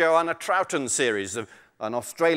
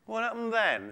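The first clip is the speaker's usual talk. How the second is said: higher, hushed tiger question; 7 semitones higher, 6 dB quieter than usual. Acted. Tiger forgetting his hunger, soft higher-pitched curiosity. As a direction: ask softly, pitched up. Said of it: "said the tiger".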